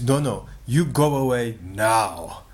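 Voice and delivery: slow, deliberate mock threat; imitated menacing order, very slow; threatening. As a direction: act out a warning, drawing out the words. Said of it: "Like, you know, threatening".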